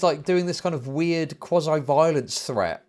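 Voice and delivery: ordinary delivery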